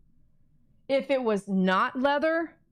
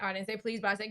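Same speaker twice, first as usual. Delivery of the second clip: ordinary talk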